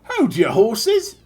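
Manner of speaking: high, loud, slow Australian shout; bad Australian, calling a halt; hammed-up Australian girl interrupting; terrible Australian accent. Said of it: "the terrible Australian".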